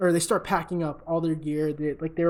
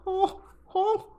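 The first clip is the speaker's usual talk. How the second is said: much higher, slow, hammed-up moaning into the phone; mock moaning rival; 9 dB louder, slower than usual. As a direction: squeal it like a show-off caught mid-act. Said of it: "he starts off the call like".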